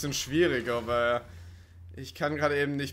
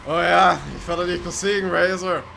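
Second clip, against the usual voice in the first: louder taunting vow; cocky challenge; 3 semitones higher, 7 dB louder than usual. Real, not acted. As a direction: throw the challenge at the rival. Taunts rival in game. (lauter, großspuriger Kampfansage)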